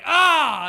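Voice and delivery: very loud, high Viking-style "ah"; rowdy roar. Acted. Guy at the bar's other end, rowdy roar. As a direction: bellow a greeting across the bar.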